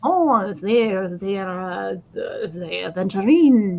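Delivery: a clumsy, slow mock French accent on aventurine; bad French accent. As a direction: try out a French accent and botch it. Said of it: "No, that's awful".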